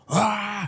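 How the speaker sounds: high short yell; mock battle cry. Nesta attacking the king, high mock yell. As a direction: let out a sharp attack shout. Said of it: "Or Nesta's like".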